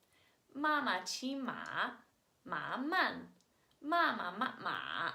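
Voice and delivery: slow Mandarin, each tone on 妈 and 马 drawn out. Shown as a demonstration. Teacher demonstrating how tones change meaning, slow Mandarin reading. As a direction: read "妈妈骑马" slowly with exaggerated tones. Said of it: "we read it like this".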